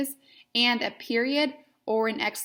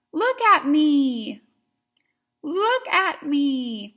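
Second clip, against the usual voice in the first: wide-eyed, loud and slow; exclamation point; 5 semitones higher, 6 dB louder, much slower than usual. Shown as a demonstration. Teacher shows the look motion, loud and excited. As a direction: call it out like a big exclamation. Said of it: "with an exclamation point at the end".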